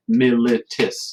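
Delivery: mi-li-tis, slow and spaced, one beat each; syllable by syllable. Shown as a demonstration. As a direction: clap out militis slowly. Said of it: "you would do three claps".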